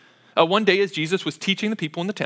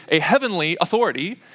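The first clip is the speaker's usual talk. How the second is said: normal talking voice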